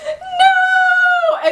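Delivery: very high crying shout of no; anguished cry. Retold or imitated. The girls watching A escape, a high crying no. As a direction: wail it as the villain escapes. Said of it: "all the girls are like".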